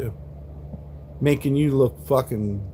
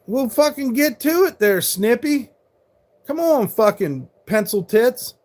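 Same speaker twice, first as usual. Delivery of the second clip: furious yelling at chat; 8 semitones higher than usual. Real, not acted. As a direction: snap back at them, full volume. Angry stream rant at chat trolls.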